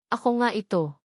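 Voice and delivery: ordinary delivery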